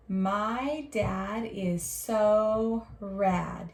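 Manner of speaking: slow, clear reading of each word; slow model reading. Shown as a demonstration. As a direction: read it slowly for the class to copy. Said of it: "My turn".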